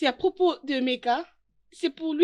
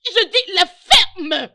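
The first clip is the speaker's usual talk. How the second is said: loud, slow, drawn-out order to shut up; angry command; 4 semitones higher, 7 dB louder, slower than usual. Acted. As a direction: silence him, stretching the last word. Angrily silences the other speaker. (ordre furieux, fort et appuyé)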